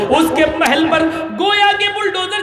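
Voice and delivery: high, shouted sermon climax; fiery preaching. Acted. Preacher shouts to the congregation about fear of God. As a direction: shout it to the crowd.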